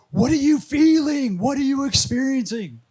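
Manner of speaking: high, loud urgent questioning; imitated yelling. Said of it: "he's, like, yelling at him".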